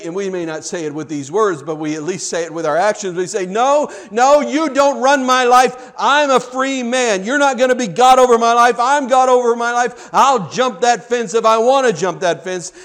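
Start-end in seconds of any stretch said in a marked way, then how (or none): 3.5-12.7 high, loud, rebellious outburst; acted-out stubborn refusal; loud defiance. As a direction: defy God at full voice. Sinner defies God, acted out.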